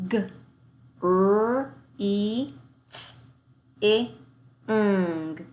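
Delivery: "Greeting" split into sounds, with "G" and "R" of the cluster kept apart; segmented sounds. Shown as a demonstration. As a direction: sound out "Greeting" one phoneme at a time. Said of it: "making both of those sounds in your cluster".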